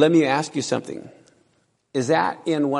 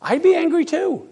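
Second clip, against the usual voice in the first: high wry aside; 12 semitones higher than usual. Acted. Preacher's sympathetic aside.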